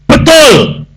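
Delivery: high, loud exclamation; emphatic high-pitched shout; shouted confirmation. Retold or imitated. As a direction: shout the answer in one emphatic burst.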